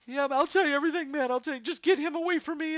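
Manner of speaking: high, fast, frightened confession; panicked blurting. Acted. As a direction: gabble it all out in terror. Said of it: "he's like".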